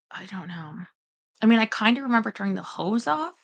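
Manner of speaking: usual speaking voice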